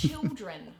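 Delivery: put-on "children" in New Zealand accent, L turned to W. Shown as a demonstration. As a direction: say "children" slowly the Kiwi way, W-like L. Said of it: "We turn our L's into W's".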